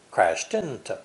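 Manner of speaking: "Crashed into" joined as "crash-tinto"; connected words. Shown as a demonstration. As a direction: carry the final t of "Crashed" into "into". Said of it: "are easily connected like this".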